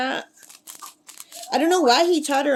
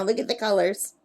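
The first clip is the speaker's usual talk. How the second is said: ordinary talk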